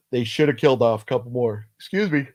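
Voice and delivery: talking through a yawn; words stretched by a yawn. Real, not acted. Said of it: "I'm starting to yawn".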